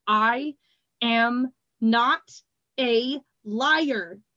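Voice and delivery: very slow, dragged protest; slow cry. A narrator's reading. Glassblower to Triss, slow stiff protest. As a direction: drag each word out in anger. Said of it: "he cried".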